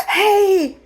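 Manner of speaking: high, loud mock hello; sudden fake friendly hey. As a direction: sing out a fake eager greeting.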